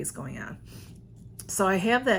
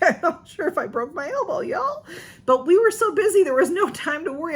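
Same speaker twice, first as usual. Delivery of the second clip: giggly excitement; 9 semitones higher, 6 dB louder than usual. Real, not acted. Laughing about maybe broken elbow.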